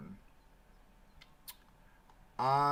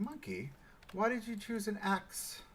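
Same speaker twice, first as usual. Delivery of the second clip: mock viewer voice; 11 semitones higher than usual. Imagined viewer question.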